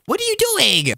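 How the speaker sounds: high-pitched indignant cry; hammed-up shocked protest; outraged yell. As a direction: call out the thief in disbelief. Confronts player who took cookies.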